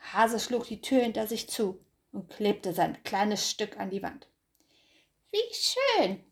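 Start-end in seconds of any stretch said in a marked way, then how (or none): 5.3-6.2 high, dreamy hare voice; hare's high thought. Acted. Hase admires himself, high and pleased. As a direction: muse much higher, falling off. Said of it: "dachte er".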